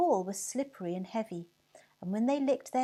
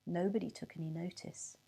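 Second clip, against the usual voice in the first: usual speaking voice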